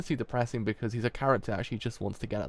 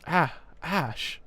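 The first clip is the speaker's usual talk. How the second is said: startled cry; 6 semitones higher than usual. Acted. Character cries out name.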